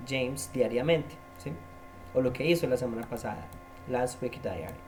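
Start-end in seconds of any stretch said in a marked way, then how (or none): none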